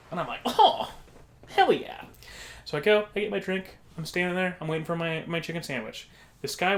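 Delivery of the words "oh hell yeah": high, drawn-up delighted "oh"; pitched way up, pleasantly surprised. Retold or imitated. Customer accepting a free drink from the counter man, delighted.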